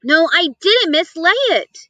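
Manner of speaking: insisting. A narrator's reading. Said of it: "insisted the boy".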